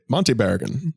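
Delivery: Monty Barragan in a clipped transatlantic accent. Shown as a demonstration. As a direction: say Monty Barragan like an old film star. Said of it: "in the transatlantic accent".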